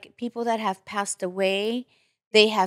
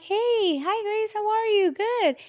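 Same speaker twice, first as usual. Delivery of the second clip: high, sugary greeting; chirpy fake-friendly hello; sweet phone voice; 12 semitones higher than usual. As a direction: greet her all sweet and bright.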